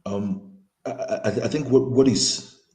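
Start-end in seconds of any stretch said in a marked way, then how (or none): none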